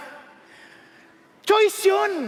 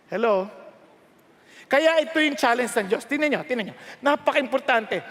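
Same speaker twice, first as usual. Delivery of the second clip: usual speaking voice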